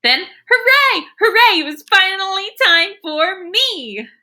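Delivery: high, loud gleeful cheer; cheering hooray. Acted. The girl cheering that her turn has come, high and loud. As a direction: cheer like it's finally your turn.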